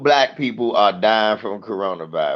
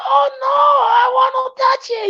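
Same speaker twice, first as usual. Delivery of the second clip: much higher, louder, whiny lady voice; pleading lady; 4 dB louder than usual. Retold or imitated. Comic mimicking a lady pleading with a toddler. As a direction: whine for what you want.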